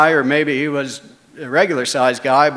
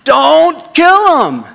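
much higher, loud, slow drawn-out yell; 9 dB louder, much slower than usual. Retold or imitated. Buddy below yelling up at the big guy, slow high shout. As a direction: scream it up from below, stretching each word. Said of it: "the guy's buddy down below is yelling".

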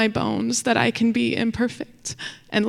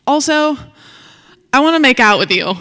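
higher, louder and rushed, out before she can stop it; nervous blurt; 5 semitones higher, 8 dB louder than usual. Retold or imitated. [name] blurting a confession to her friend by the river, nervous rush. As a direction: blurt it out fast and loud. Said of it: "just blurting out things I feel uncomfortable about".

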